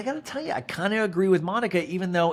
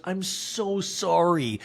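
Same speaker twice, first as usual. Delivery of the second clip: drawn-out apology; 4 semitones higher, much slower than usual. Retold or imitated. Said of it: "And Linda goes".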